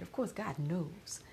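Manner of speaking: usual speaking voice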